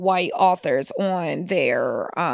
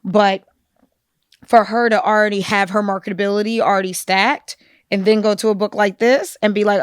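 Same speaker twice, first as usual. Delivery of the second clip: usual speaking voice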